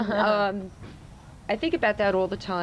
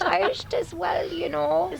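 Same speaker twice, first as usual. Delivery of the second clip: Irish accent; 8 semitones higher than usual. Shown as a demonstration. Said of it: "I like Irish".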